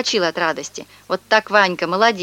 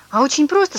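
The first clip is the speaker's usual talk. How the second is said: brisk, higher matter-of-fact tone; businesslike; 8 semitones higher than usual. Acted. Swindler suddenly turns businesslike, brisk. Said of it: "сказал этот парень деловитым голосом".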